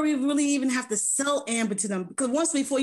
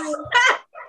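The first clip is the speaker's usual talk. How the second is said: short high laugh; 12 semitones higher, 5 dB louder than usual. Real, not acted. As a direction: burst into a laugh. Laughs at the joke.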